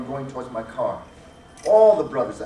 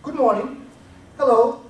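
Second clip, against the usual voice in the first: friendly greeting; 9 semitones higher, 9 dB louder than usual. Shown as a demonstration. Greeting strangers in an elevator.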